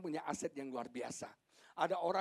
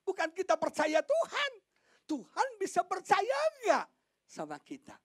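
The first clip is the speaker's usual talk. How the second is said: high, loud challenge from the pulpit; full-force preaching; 8 dB louder than usual. Real, not acted. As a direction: hurl it at the congregation, high and loud. (khotbah lantang dengan nada tinggi)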